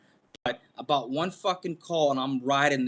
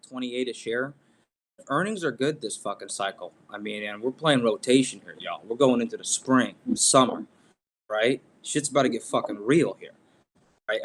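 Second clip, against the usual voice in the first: ordinary talk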